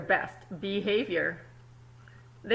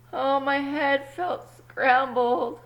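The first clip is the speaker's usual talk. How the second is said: hammed-up weary egg, louder and higher; dramatic complaint; 5 semitones higher, 7 dB louder, slower than usual.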